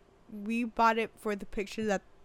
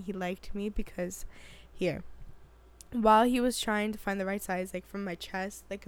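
ordinary talk, nothing marked

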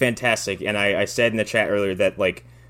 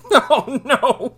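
mock horror; 13 semitones higher than usual. Mock dread at movie setup.

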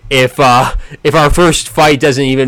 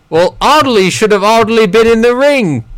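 high, hammed-up British accent; clumsy mock-British voice; bad British accent; 6 semitones higher than usual. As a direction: put on a deliberately poor British accent, pitched up. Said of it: "instead of a bad British accent".

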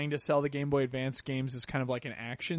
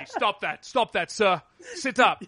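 raised, mock British constable giving orders; terrible British accent; 5 semitones higher, 4 dB louder than usual. Constable ordering a vomiting drunk, in hammed-up British accent. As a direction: put on a bad British policeman's accent. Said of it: "my terrible British accent".

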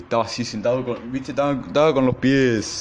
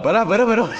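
much higher and louder frightened pleading; mock panicked pleading; 12 semitones higher, 6 dB louder than usual. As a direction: beg them to stop in a hammed-up panic. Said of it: "cagado de miedo".

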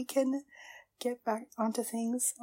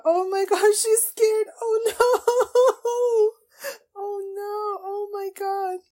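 tearful outcry; 11 semitones higher, 7 dB louder, much slower than usual. Real, not acted. Fan upset that the heroine is scared, tearful high wail. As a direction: wail through tearful laughs, easing off. Overcome by scared character onscreen.

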